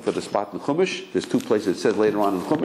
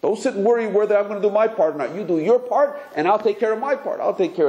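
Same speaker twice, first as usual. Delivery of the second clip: boss reassuring; 12 semitones higher than usual. Pretend. Boss answers worried worker.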